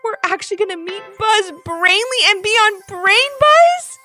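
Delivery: put-on high, drawn-out excited kid voice; thrilled disbelief. A narrator's reading. Levi marvels to his parents about the show, excited exclamation. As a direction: stretch it out in high-pitched amazement.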